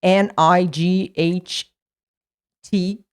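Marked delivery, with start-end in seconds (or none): none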